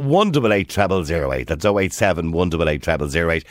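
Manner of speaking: ordinary talk, nothing marked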